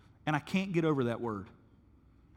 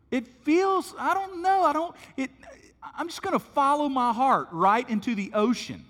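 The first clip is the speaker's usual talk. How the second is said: much higher, louder, a silly confused voice; clueless pilot; 11 semitones higher, 6 dB louder than usual. Pretend. Preacher acts a lost pilot following his heart, silly high voice. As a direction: play a dithering pilot heading into the ocean.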